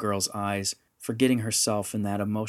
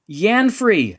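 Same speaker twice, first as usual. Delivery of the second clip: high, loud yell of a name; 11 semitones higher, 10 dB louder than usual. A narrator's reading. Rendra to Yanfrey down the passageway, yelling. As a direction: yell to get someone's attention. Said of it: "she yelled".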